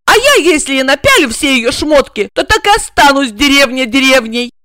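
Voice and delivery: high, loud jealous rant; jealous outburst. A narrator's reading. Regina rants at Igor about the rival, high and loud. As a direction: pour out the jealousy.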